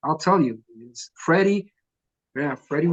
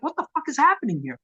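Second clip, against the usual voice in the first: higher, incredulous, a touch of mock panic; retold disbelief; 10 semitones higher than usual. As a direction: ask it like the room makes no sense. Retold disbelief at bored bikers.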